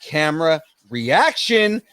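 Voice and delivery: high, drawn-out segment intro; hyped show announcer. Acted. Host introducing the trailer reaction, hyped announcer. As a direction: announce it big, stretching the key words.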